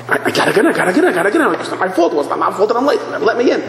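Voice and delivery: high, whiny mock begging; hammed-up desperate latecomer; frantic pleading. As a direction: beg to be let in, words tumbling out. Acted latecomer frantically begging entry.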